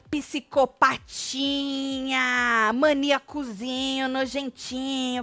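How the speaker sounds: slow, drawn-out singsong taunt; imitating the young woman. Deolane insults someone for watching the girls, drawn-out imitation. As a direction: stretch each diminutive in a mocking impression. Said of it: "Você já tá falando igual a moça lá".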